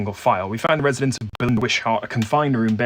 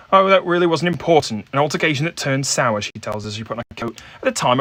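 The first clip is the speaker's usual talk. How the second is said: higher, louder, brisk woman brushing off the question; brisk dismissive Maisie; 4 semitones higher, 4 dB louder than usual. Acted. Game master voices Maisie as she rushes off to an appointment, brisk and dismissive. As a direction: hurry them out the door, dismissive.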